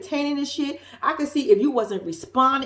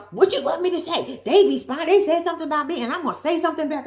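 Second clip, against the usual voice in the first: mock indignant higher reply; retold defensive girlfriend; 6 semitones higher than usual. To her boyfriend, a mildly hammed-up indignant retort. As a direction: voice her snapping back at him. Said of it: "She responds by".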